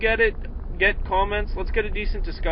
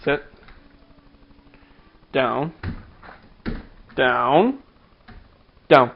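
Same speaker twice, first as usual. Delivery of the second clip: dog commands; 2 semitones lower, much slower than usual. Real, not acted.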